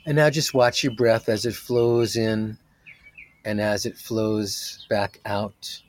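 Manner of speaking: slow, calm meditation voice; soothing guide talking a group through breathing; guided meditation. Acted. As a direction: ease them into stillness, unhurried.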